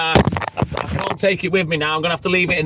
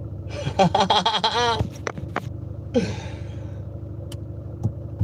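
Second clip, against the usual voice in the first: breathless laugh at own joke; 8 dB quieter than usual. Real, not acted.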